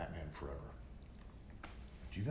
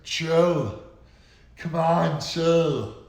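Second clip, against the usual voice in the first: loud, higher-pitched movie-star impression; Schwarzenegger; 8 semitones higher, 14 dB louder, much slower than usual. Mocking Schwarzenegger's Batman lines to viewers, loud impression. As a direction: bellow it like an action star's bad one-liner.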